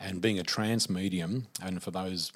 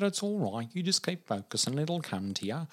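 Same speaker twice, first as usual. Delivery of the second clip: put-on higher, coaxing old lady voice; reassuring old lady; 8 semitones higher than usual. Healer reassuring the teller, higher and coaxing. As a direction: soothe the frustrated student.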